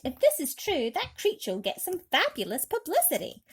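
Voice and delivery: excited high cry; policemen crying out. A narrator's reading. Policemen crying out to the crowd, excited. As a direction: call it out like a crowd of cops. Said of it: "fifty-two policemen cried".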